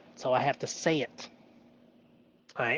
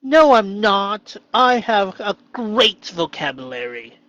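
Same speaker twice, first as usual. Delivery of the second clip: loud, slow, higher defiant no; playful disagreement; 5 semitones higher, 9 dB louder than usual. Pretend. Disagreeing with his shadow voice, loud and proud. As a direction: push back proudly and slowly. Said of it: "And then also not".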